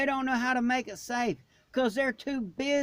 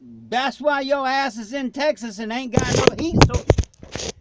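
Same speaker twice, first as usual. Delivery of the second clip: louder, fired-up outburst; ranting louder at the unprepared; heated rant; 3 semitones higher, 7 dB louder than usual. Real, not acted. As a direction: let the frustration burst out. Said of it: "I did it again".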